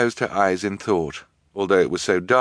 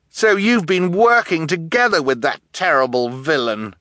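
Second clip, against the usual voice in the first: raised, high accusing call; 10 semitones higher, 5 dB louder than usual. A narrator's reading. Orphan boy to treacherous guardian above, calling up accusingly. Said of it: "Klaus called up to her".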